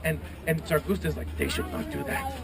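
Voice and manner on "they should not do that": very low, quiet menace; deep, restrained disapproval; angry griffin. Acted. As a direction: rumble it softly in the griffin's voice. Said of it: "he gets really mad".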